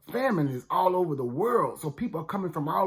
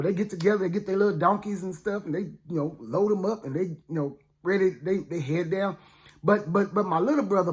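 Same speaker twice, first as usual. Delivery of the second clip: ordinary delivery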